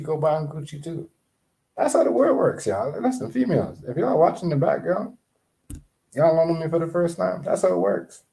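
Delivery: high-pitched, insistent urging; emphatic exhortation. Real, not acted. Exhorting women listeners to make it happen.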